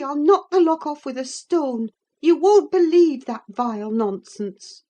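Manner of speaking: high-pitched, emphatic protest; indignant calling out. A narrator's reading. Narrator defending the child from the rider's falsehoods, indignant. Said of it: "I called from the inside".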